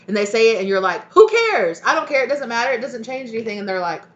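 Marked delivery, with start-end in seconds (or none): 1.1-3.6 shrill, much higher and louder brush-off, fading at the end; mock a listener who couldn't care less; loud sarcastic "who cares" that trails down; scornful dismissal.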